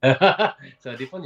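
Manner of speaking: slow laughing, mocking repeat; scornful sigh. Real, not acted. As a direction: laugh it out slowly, teasing. Mocks partner's hedged answer. (répétition moqueuse en riant)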